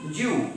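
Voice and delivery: "you" said as "ju", low and falling; contracted "do you". Shown as a demonstration. Teacher isolating the merged sound, "ju".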